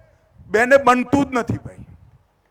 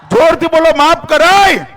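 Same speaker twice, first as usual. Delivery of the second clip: calling out to crowd; 6 semitones higher, 7 dB louder than usual. Real, not acted. Rally crowd called to answer.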